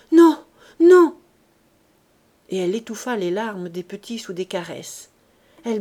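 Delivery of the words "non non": very loud, high desperate cry; frantic stammer. A narrator's reading. Wife begging her partner not to leave, loud and desperate. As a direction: beg him not to go.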